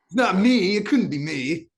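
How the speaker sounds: loud, high mock protest; mock denial. Student joking about flunking French, loud mock denial. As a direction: protest your innocence, hammed-up.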